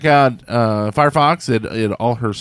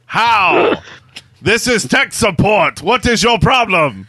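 high-pitched mock Indian accent; 7 semitones higher, slower than usual. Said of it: "say the following message in an Indian accent".

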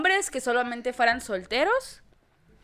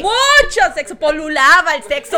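laughing defiant shout; 5 semitones higher, 13 dB louder than usual. Acted. Shouting at her dad watching.

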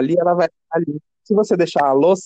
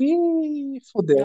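high, soft oh-no; sinking realisation, pitched up; dismayed; 9 semitones higher, 4 dB quieter, much slower than usual. Retold or imitated. Dread at having to phone.

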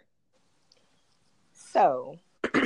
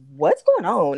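louder, pressing demand into the phone; urgent loud question; 4 semitones higher, 13 dB louder, slower than usual. Retold or imitated. Retelling calling roommate about police, loud and urgent. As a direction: call out to get her answer now.

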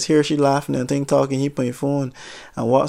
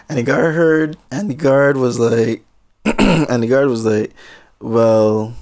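usual speaking voice

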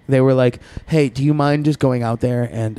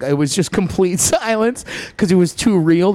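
ordinary talk, nothing marked

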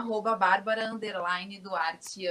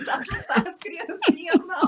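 laughing; 7 semitones higher, 4 dB louder than usual. Real, not acted. Laughs mid-sentence.

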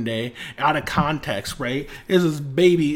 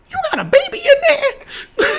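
put-on much higher, slow and louder old mammy voice; a squeaky put-on mammy voice through a laugh; 4 dB louder than usual. Retelling a cartoon baby's line, high loud mammy voice. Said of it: "in the mammiest ass voice".

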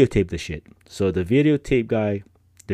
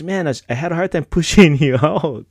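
laughing instructor; 8 semitones higher, 6 dB louder than usual. Retold or imitated. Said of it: "He goes".

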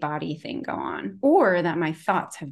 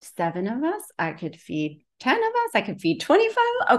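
mock calculating; 5 semitones higher than usual. Counting food stores, escalating.

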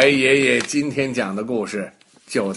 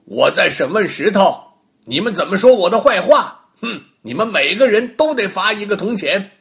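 louder, indignant with a huff; annoyed scolding; 7 semitones higher, 8 dB louder than usual. Acted. Angry Baogong fines the jeering onlookers, loud indignant scolding. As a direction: judge rebuking the mocking crowd. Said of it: "包公听了很生气".

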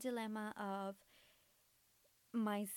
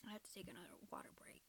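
whisper; 11 dB quieter, much faster than usual. Real, not acted. Whispered aside.

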